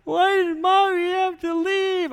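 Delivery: put-on high, wailing little girl's cry; imitating a bawling child. Dad retelling his daughter's wail, high crying child voice. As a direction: play the sobbing six-year-old. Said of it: "she just starts bawling".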